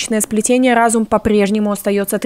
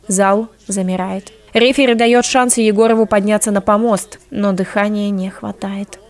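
ordinary talk

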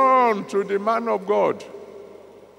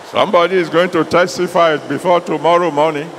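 loud, urgent declaration; preaching at full force; 6 dB louder than usual. Acted. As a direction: proclaim it over the crowd.

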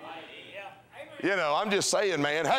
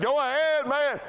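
high, louder urging; impersonators egging him on; 10 semitones higher, 4 dB louder than usual. Retold or imitated. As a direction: cheer him on like a rowdy crowd. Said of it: "They looked, they said".

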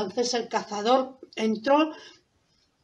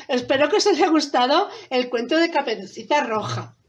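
ordinary delivery, nothing marked

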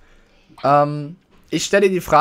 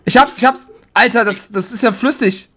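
aufgeregt; 6 semitones higher, 4 dB louder than usual. Real, not acted. Egg finally opened, excited.

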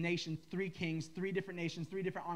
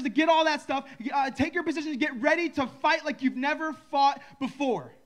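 loud, high call to arms, building; battle cry; 5 semitones higher, 7 dB louder than usual. Acted. Bob misquotes the verse as a loud call to fight. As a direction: rouse the troops for war. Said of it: "He says".

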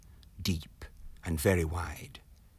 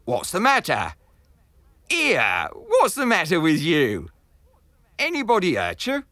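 loud, higher peddler voice; gruff peddler calling out; 9 semitones higher, 9 dB louder than usual. Acted. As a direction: call out to the stranger at his feet. Peddler calls to fallen man.

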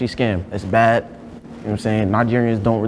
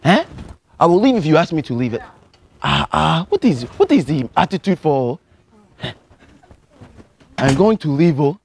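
put-on lightly held Nigerian accent, earnest student; a Nigerian student pleading with the teacher; 3 semitones higher than usual. To a geography teacher, a mild Nigerian accent. Persona leaving the class.

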